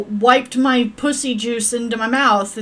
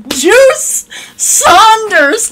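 high, loud, drawn-out name; hammed-up slow proclamation; mock announcer; 10 semitones higher, 9 dB louder, much slower than usual. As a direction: stretch the name out like a grand announcement. Announcing the Sim's full name.

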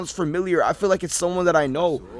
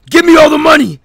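loud, higher demand; intimidating demand; 10 semitones higher, 14 dB louder, faster than usual. Acted. Captor intimidating Tyrell for money, loud demand. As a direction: snap it like a threat. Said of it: "intimidate him".

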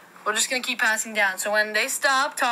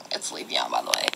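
much lower, deep put-on voice for a toy; deep, growly toy Leafeon voice; 5 dB quieter than usual. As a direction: drop way down like a big creature. Kid voicing Leafeon toy, deep.

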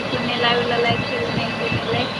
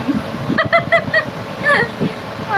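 high burst of laughter. Real, not acted. Laughing during live chat.

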